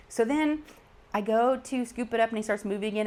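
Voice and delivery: ordinary talk, nothing marked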